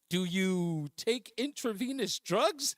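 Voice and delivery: slow, deliberate doctor-like questioning; slow clinical question. Retold or imitated. Friend Sophia quizzing the worried teen, slow and clinical. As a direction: spell each word out like a careful nurse.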